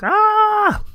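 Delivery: shrill frightened shriek of a mouse; high startled scream. Acted. Mouse chased by geese.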